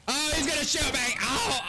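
high-pitched alarmed shout; panicked yell. Pretend. Player about to get shot in the game, panicked yell. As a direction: yelp it out in panic.

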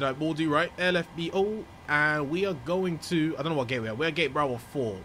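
normal talking voice

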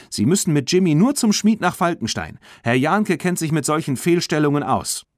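put-on high, insistent young voice; loud, lively persuasion; eager urging. A narrator's reading. Girl urges the man to act.

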